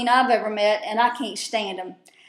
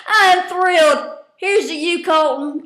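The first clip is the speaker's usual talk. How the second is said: mock toast; 6 semitones higher, 4 dB louder than usual. Real, not acted. Mock toast to departed player.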